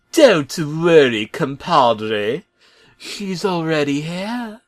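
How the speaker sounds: slow, high sly reassurance; sly Bob. Acted. Bob teasing the truck about a surprise, in a slow sly high voice. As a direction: tease the trucks with a secret. Bob's voice.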